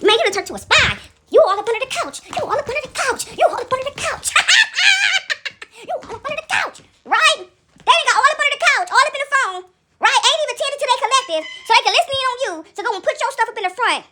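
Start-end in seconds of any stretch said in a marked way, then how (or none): 2.3-8.9 high, squealing mock voice with laughter. Mocking the spy, laughing. 8.9-14.0 high teasing voice; high mocking voice.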